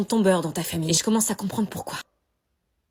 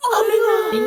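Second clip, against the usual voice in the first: shocked shout; 12 semitones higher, 9 dB louder, much slower than usual. Acted. Shock at the piercing.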